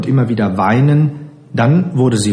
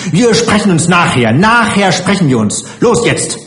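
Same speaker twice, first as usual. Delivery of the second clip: higher-pitched, scolding threat; zornig drohend; 8 semitones higher, 3 dB louder than usual. A narrator's reading. Matron threatening the runaway child. (wütend drohende Heimleiterin, höhere Stimme)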